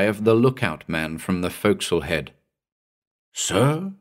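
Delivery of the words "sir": faint distant voice. A narrator's reading. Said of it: "A little voice, long drawn out".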